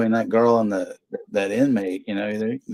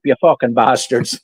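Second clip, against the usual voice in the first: hammed-up leprechaun cursing, pitched up; 2 semitones higher, 5 dB louder than usual. Cursing everyone as Cooter O'Brien, mock leprechaun voice. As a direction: curse them out like a loud mock leprechaun. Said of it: "You sound like a leprechaun".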